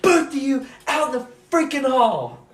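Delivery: lightly voiced strict teacher; teacher sending kids to the hall; stern teacher. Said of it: "He's like".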